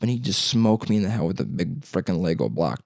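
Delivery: ordinary talk, nothing marked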